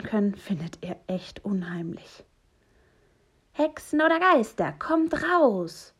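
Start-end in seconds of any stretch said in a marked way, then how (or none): 3.5-5.9 bold, loud high-pitched challenge; ringing, plucky shout; brave call. A narrator's reading. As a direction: call them out like a fearless little hero. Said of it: "ruft Poki mutig".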